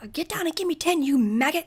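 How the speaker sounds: mock drill sergeant, high and loud; drill sergeant yelling. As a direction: bark a hammed-up order at a recruit. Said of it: "a drill sergeant yelling at them".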